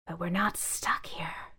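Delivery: soft breathy whisper; whispering bag. Acted. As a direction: whisper it slowly. Bag speaking.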